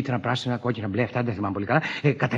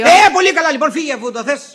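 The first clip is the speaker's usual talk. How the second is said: high, loud outburst; angry dismissal; 12 semitones higher, 9 dB louder than usual. Acted. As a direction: shout the wife out in a huff. Husband snaps as wife leaves. (θυμωμένο ξέσπασμα)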